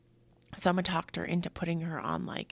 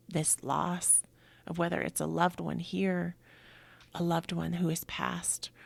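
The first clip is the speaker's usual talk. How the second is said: ordinary talk, nothing marked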